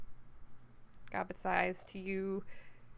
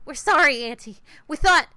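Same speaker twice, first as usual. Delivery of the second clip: higher, louder, pleading underling; loud, high apology; 6 semitones higher, 15 dB louder than usual. Acted. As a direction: squeal an apology to Auntie. Scared underlings apologise to boss.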